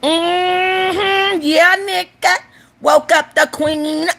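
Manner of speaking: high, drawn-out sassy genie voice. Acted. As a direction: stretch it out like a queen just woken.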